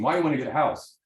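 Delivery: ordinary talk, nothing marked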